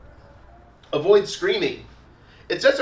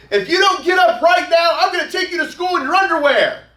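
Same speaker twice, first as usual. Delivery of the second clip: high, loud yelled threat; angry shouted threat; 13 semitones higher, 9 dB louder than usual. Pretend. Parent yelling at sleeping kid to get up, angry shout. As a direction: lose your temper and bellow it. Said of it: "Sometimes our emotions get the best of us".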